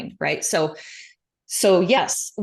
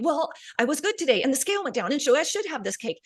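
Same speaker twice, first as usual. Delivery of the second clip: mocking excuse voice; 6 semitones higher than usual.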